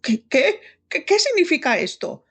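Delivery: high-pitched flustered stammer; agitated, stuttering bewilderment, pitched high; spluttering incoherence. A narrator's reading. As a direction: splutter the questions, tripping over first words. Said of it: "intentaba pronunciar palabras que se negaban a salir".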